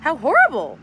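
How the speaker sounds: high, loud wail of dismay. A narrator's reading. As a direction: cry it out in horror. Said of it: "wailed Missus Semicolon".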